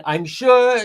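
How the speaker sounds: high, loud mocking impression of a woman's strong accent; mock Charlene Lima accent. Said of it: "in that strong Eileen Violent accent".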